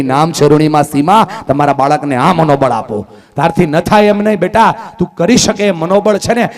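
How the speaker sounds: fiery preaching. Acted. Speaker urging parents to give children willpower, loud preaching. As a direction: rouse the whole hall. Preaching at full force to audience.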